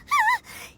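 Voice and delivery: a high, jittery little laugh; nervous laugh. Acted. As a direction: laugh it off nervously before the tunnel. Nervous before the dark tunnel.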